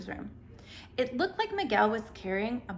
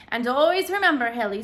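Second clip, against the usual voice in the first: put-on Irish brogue, louder, fond old man; lilting Irish grandfather sharing a saying; thick Irish brogue; 3 semitones higher, 9 dB louder than usual. A narrator's reading. Ancestor Patrick advising Haley in a thick Irish brogue. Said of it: "said her great-great-great-grandfather".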